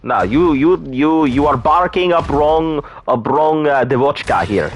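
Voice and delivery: high, hammed-up Russian accent denying it; fake Russian accent. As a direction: play a joke Russian who insists he's not Russian. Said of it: "No, no accent".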